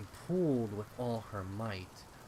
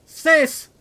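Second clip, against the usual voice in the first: desperate cry; 24 dB louder than usual. Acted. Sister falling into well.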